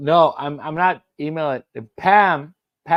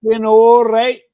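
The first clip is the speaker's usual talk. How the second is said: loud, high celebration yell; jubilant shout; 9 semitones higher, 10 dB louder than usual. Real, not acted. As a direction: shout it out, the game is won. Celebrating three nil lead.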